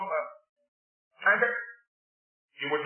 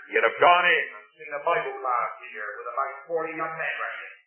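ordinary talk, nothing marked

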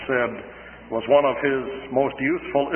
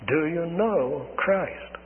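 slow, softened, higher question with space round each word; searching question; 5 semitones higher, 4 dB quieter, much slower than usual. Real, not acted. Preacher asking the congregation directly, slow and searching. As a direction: put it gently and deliberately to each listener.

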